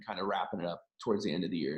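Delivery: ordinary talk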